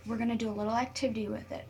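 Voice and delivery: ordinary talk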